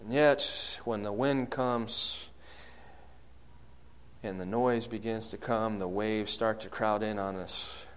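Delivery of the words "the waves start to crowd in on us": ordinary talk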